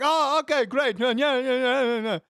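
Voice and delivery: high, loud mock-cheery then no no no; imitating his own letdown at the game, hammed-up; mock dismay. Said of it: "And I'm like".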